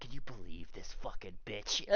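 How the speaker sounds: whispered, conspiratorial aside; whispering. Acted. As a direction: whisper it behind her back. Muttered aside to the party.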